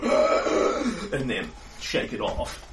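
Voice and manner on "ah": a breathy clutching gasp. Retold or imitated. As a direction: gasp while grabbing your heart.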